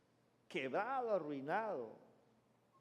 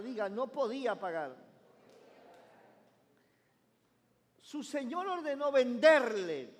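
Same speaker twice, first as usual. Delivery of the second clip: loud, higher proclamation of the parable; preaching scripture reading; 3 semitones higher, 5 dB louder than usual. Acted. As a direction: proclaim the text to the congregation. Reads scripture from pulpit. (lectura bíblica proclamada con fuerza)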